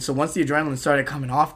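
ordinary talk

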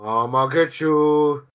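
slow, drawn-out playful threat; bear. Retold or imitated. Bear closing in on hiding prey, slow taunt. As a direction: draw it out like a stalking bear. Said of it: "The bear goes".

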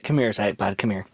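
coaxing. Acted. Character luring the dwarf over, soft coaxing call. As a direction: hush it down to draw him close.

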